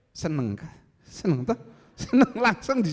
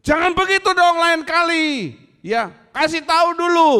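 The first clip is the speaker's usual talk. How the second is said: raised, much higher and louder firm rebuke; high-pitched scolding; 11 semitones higher, 6 dB louder than usual. Shown as a demonstration. Preacher showing the congregation an allowed high, loud telling-off. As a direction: scold loudly but without insults. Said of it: "Kalau marah nada tinggi oke".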